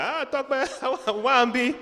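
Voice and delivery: laughing, high imitation of dad calling out loudly and slowly; mock dad yelling. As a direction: play the dad calling across the schoolyard, half laughing. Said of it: "he would yell at me in Yoruba very loudly".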